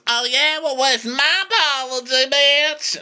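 high-pitched mock confrontation; Erica. Erica demanding her apology, mock-indignant high voice. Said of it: "And Erica goes".

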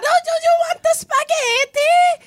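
put-on high, loud over-the-top Italian accent; shrieky Italian accent. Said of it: "It gets very loud and shrieky".

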